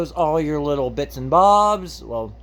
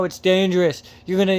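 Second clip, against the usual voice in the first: ordinary delivery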